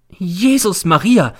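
high, loud, drawn-out cry of dismay; horrified cry. A narrator's reading. Landlady hears it is the police, horrified cry. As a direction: cry out aghast, stretching the words. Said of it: "schreit die Hausfrau und wird sehr entsetzt".

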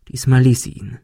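plain reading voice

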